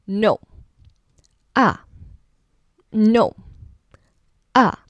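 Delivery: short falling "No. Ah." cut off sharp; fourth tone drop. Shown as a demonstration. Teacher showing the short angry falling fourth tone. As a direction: snap a quick falling no. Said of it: "Short, angry, kind of like you saying no".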